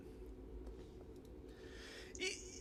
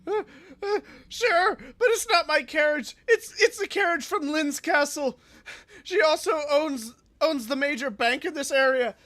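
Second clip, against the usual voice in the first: higher, louder frightened pleading; terrified begging; 8 semitones higher, 4 dB louder than usual. Acted. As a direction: plead for your life in panic. Terrified noble begs demon.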